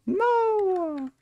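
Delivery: very high squeaky no; squealed no. Real, not acted. Streamer squealing no at chat.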